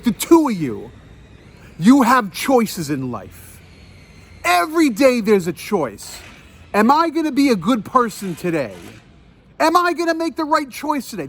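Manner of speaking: preachy scolding. Retold or imitated. Man points at the couple and lectures on choices, re-enacted sermonizing. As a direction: lecture them with deliberate, righteous insistence.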